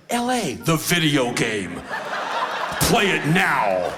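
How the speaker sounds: slow, booming ad voice; video-game announcer. Acted. Mimics video game advert.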